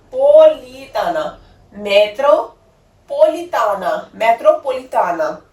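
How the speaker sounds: teacher breaking a long Italian word into chunks; word split in parts. Shown as a demonstration. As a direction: build the long word piece by piece. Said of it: "ਪਹਿਲਾਂ ਮੈਟਰੋ ਬੋਲ ਦੋ, ਫਿਰ ਬੋਲ ਦੋ".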